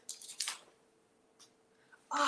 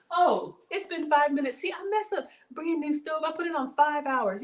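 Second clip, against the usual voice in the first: surprised; 7 semitones higher than usual. Real, not acted. Realises timer mistake.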